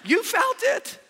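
loud, high exclamation; fervent preacher's cry; shouted. Acted.

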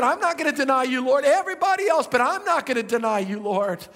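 hammed-up loud loyal protest; loud insistent Peter. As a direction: swear you'll never deny him, loud and fast.

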